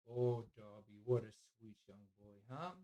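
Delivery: soft, low cooing to the dog. Real, not acted. Owner cooing softly to his sleepy dog. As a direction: coo softly at a sweet pet.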